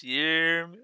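ordinary delivery

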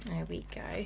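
whispered, hushed. Real, not acted. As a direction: barely breathe the words. Taking the baby out.

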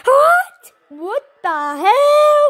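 loud, stretched disbelief; shocked. Real, not acted. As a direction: blurt it out in shock. Reacting to opponent's score.

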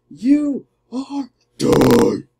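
very slow, stretched "You are done!"; hammed-up slow menace; drawn-out threat. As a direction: drag each word out to mock.

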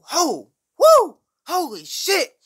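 very high, stretched-out adrenaline whoops; amped, high-pitched holler of amazement; hyped up. Retold or imitated. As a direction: whoop it out like a huge rush just hit. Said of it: "I was hyped, boy".

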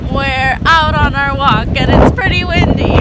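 loud, high outdoor voice; calling over wind. Real, not acted. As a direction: raise your voice over the wind.

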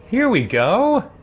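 ordinary delivery

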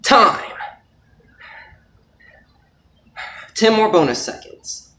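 strained, loud, effortful voice; holding a plank. Real, not acted. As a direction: push the words out while straining. Straining through a five minute plank.

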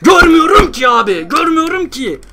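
high, loud exasperated yell; frustrated shout. Real, not acted. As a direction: shout it out in real frustration at the game. Frustrated gamer can't see enemies.

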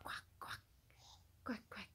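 breathy whispered quack quack; whispered duck quack. Pretend. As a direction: whisper the duck sound softly to a child. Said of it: "How about the duck?".

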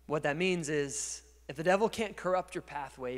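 normal talking voice

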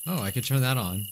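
ordinary talk, nothing marked

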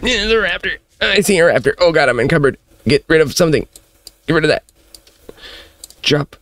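high, frantic alarm; high-pitched, flustered game panic; panicking. Real, not acted. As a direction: blurt urgent orders in a real panic. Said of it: "I'm panicking".

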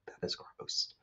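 whispered disgusted aside. Real, not acted. Disgusted aside during read-aloud.

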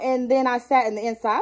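normal talking voice

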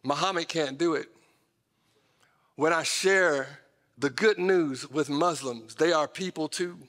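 normal talking voice

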